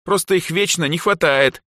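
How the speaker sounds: high, aggrieved complaint; offended. A narrator's reading. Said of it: "обиженно буркнула Эльза".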